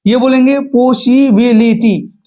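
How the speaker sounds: पॉसिबिलिटी drawn out slowly, syllable by syllable. Shown as a demonstration. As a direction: spell out पॉसिबिलिटी in five slow beats. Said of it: "पांच हो गया".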